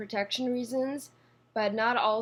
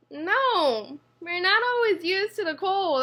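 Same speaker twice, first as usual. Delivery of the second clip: emphatic denial; 8 semitones higher, 4 dB louder than usual. Real, not acted. Retorting to the quoted question.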